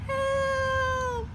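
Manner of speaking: high, far-off cry for help; calling for help. Acted. Trapped numbers calling to Zero, high distant cry. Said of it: "call for help".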